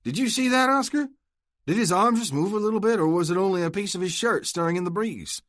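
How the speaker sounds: high, loud mock alarm; exaggerated urgency. Luke taunts Oscar about his dying brother, mock alarm. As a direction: play up false urgency to torment him.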